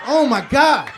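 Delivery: high, loud hammed-up exclamation; overcome outburst. Comedian acting out the thigh massage, hammed-up loud exclamation. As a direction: cry out as if overwhelmed.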